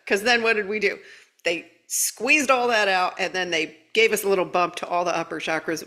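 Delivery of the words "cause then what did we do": ordinary talk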